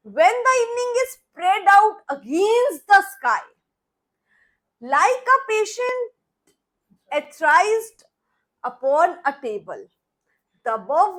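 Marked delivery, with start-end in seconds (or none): 0.1-9.8 slow, louder dramatic poetry reading; poem recitation. Shown as a demonstration. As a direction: linger on each phrase like reciting on stage. Recites Eliot poem to class.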